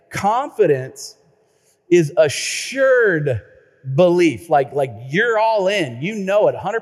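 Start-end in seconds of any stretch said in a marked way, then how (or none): 0.1-4.5 slow, deliberate, word by word; slow dictation. Shown as a demonstration. Pastor dictating a definition to the congregation, slowly. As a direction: spell it out slowly so they can write it down. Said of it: "Write that down".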